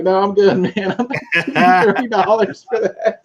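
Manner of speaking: high, amused brush-off; laughing refusal. Retold or imitated. Turning down the van guy's thirty dollar CD, laughing high.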